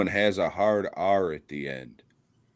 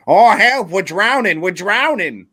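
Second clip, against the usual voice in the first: much higher, louder hammed-up Maine accent; mock Maine accent; 11 semitones higher, 11 dB louder than usual. Mocking Mainers falling into the ocean, a loud mock Maine cry for help. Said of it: "Rhode Island, Maine accent".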